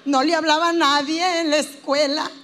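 very slow, drawn-out whine; hammed-up therapy patient, haltingly dragging every phrase; self-pitying patient. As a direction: stretch the last vowels into a near sing-song moan. Acting the patient again.

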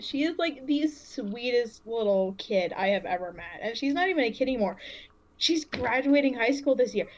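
ordinary talk

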